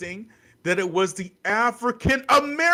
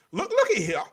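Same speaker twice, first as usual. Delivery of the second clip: ordinary delivery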